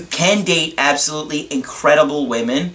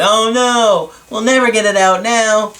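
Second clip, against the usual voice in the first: mock panic; 6 semitones higher than usual. Luke watching his fighter sink, mock panic. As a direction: imitate Luke panicking over his sinking ship. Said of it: "So Luke panics".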